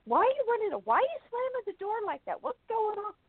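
mom's voice. Retold or imitated. Mom voiced in a story.

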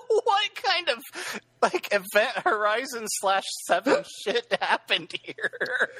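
high-pitched words through real laughter; laughing disbelief. Real, not acted. Player reacting to a grim story twist, laughing disbelief. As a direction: crack up while asking, incredulous.

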